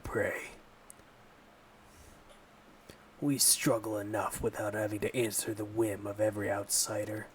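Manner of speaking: a weak attempt at a gruff growl; gruff, hostile village leader; growly voice. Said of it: "I did not have a good growly voice at all".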